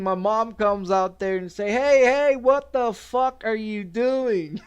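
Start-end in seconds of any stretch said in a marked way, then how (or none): 1.7-4.6 loud, higher, laughing mock yell; mom yelling. Mom catches him on the job, mock yelling. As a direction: belt it like an angry mom, laughing.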